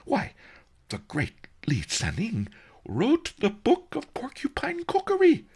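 incredulous high-pitched wonder; amazed. A narrator's reading. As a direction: build to an astonished peak. Merchant amazed at the famous name.

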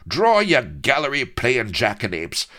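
high, loud mocking taunt; raging pirate goading the captain to fight; sneering. A narrator's reading. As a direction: sneer him into drawing. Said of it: "sneered the raging colonel".

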